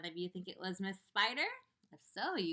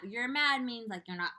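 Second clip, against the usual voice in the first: plain reading voice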